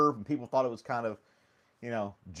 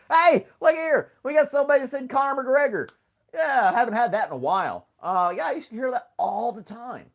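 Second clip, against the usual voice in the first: loud, high-pitched holler; hammed-up shouted announcement, pitched way up; mock excitement; 12 semitones higher, 9 dB louder than usual. Streamer announcing a viewer's Conor McGregor comment, mock-excited holler.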